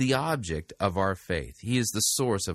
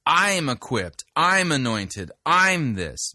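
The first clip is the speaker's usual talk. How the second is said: "I" punched higher and louder each time; stress on I; 5 semitones higher, 5 dB louder, slower than usual. Retold or imitated. Host mimics a preacher's self-centred emphasis, heavy stress on "I". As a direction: lean hard on every "I", letting the rest fall away. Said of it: "Now, notice the emphasis".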